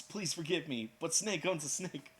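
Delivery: normal talking voice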